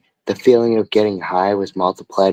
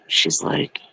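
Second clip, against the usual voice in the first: normal talking voice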